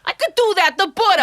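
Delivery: high loud Bronx voice; Bronx accent. Retold or imitated. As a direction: play it thick Bronx. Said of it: "The Bronx".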